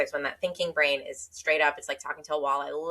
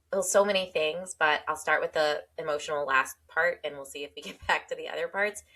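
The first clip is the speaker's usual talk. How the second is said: ordinary talk, nothing marked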